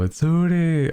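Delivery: high-pitched delighted exclamation; excited recognition. Retold or imitated. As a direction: cry out as if spotting your favourite owl. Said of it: "and I go".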